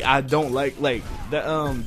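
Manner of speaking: normal talking voice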